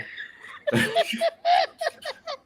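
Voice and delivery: long high-pitched real laugh. Real, not acted. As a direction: burst into sustained high laughter. Cracks up at the air marshal bit.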